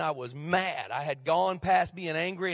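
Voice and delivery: normal talking voice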